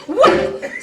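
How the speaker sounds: sharp loud high whack of a belt. Acted. Aunt's belt strikes the girl, loud whack. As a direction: crack it out like leather hitting skin.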